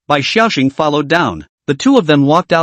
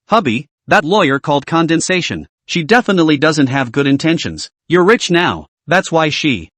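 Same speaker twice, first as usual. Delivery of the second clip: ordinary delivery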